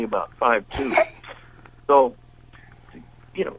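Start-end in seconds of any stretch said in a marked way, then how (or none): none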